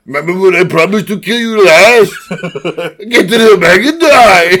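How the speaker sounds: loud, high Austrian bellow breaking into laughter; hammed-up action-hero shout, cracking up; Schwarzenegger impression. Co-host quoting movie lines in laughing Schwarzenegger voice.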